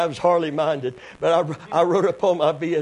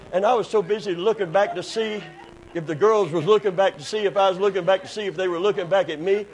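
ordinary talk, nothing marked

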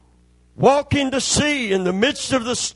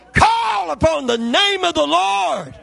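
loud, higher shouted preaching climax; preaching at full force; 5 semitones higher, 3 dB louder than usual. Acted. Preacher urging the congregation to call on God, shouting at full force. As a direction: build to a shouting revival peak.

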